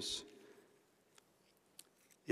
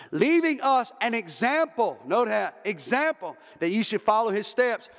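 full-force preaching; 8 semitones higher than usual. Acted. Preacher pleading with the congregation over Jesus on the cross, full-force preaching.

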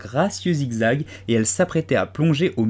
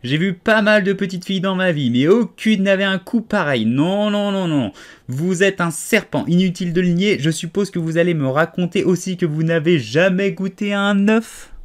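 accusing pigeon; 7 semitones higher, 5 dB louder than usual. A narrator's reading.

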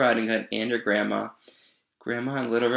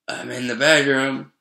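wolf posing as grandma, louder; strange, wobbly voice; 3 semitones higher, 5 dB louder than usual. A narrator's reading. Wolf answering from the bedroom, wobbly fake grandma. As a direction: call back with a wobble. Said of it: "in a strange, wobbly voice".

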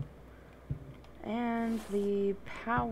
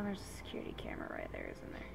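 whispering; 13 dB quieter, faster than usual. Real, not acted. Sneaking past a camera.

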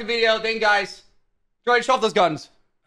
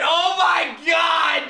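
high, stretched-out exclamation; amazed cry; 5 semitones higher, much slower than usual. Real, not acted. Friend sees the bare waxed patch, amazed cry. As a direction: draw it out in disbelief.

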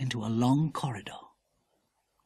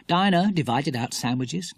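usual speaking voice

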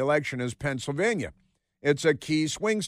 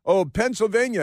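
mock orator, pitched far up; Josh Shapiro; 8 semitones higher, 4 dB louder than usual. Host mocking the governor's speech, high mock-oratory. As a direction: put on a politician's speech voice. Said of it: "Josh Shapiro is saying".

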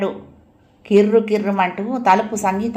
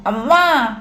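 put-on child's stubborn yell for mother, pitched up; mild tantrum cry, falling at the end; petulant shout; 4 semitones higher, 3 dB louder, much slower than usual. A narrator's reading. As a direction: shout for Amma, stamping mad. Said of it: "కాలు నేల మీద బాదుతూ అరిచాడు".

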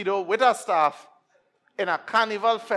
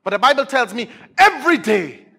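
loud scripture rising to a shout; fervent pulpit cry; full-force preaching; 5 semitones higher, 11 dB louder than usual. Real, not acted. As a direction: build to a high, shouted proclamation.

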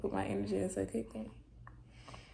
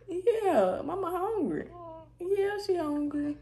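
laughing; 10 semitones higher, 4 dB louder, slower than usual. Real, not acted.

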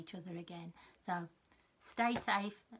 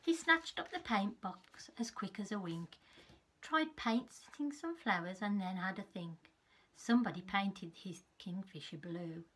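put-on lively read-aloud for small children; story narration. Teacher reading a picture book to her class. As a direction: tell it like a bedtime storybook.

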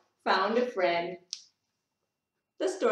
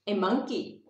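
plain reading voice

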